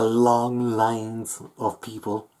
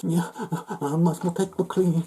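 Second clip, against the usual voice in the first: high, whiny fake crying; mock crybaby; 9 semitones higher than usual. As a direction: imitate a crybaby, pitched way up. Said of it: "cries a little bitch".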